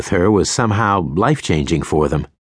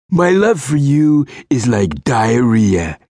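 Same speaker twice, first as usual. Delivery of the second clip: slow, higher smarmy line; sleazy pickup voice; 6 semitones higher, 3 dB louder, slower than usual. A narrator's reading. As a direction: deliver it like a cheesy flirt. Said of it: "Sunglasses said".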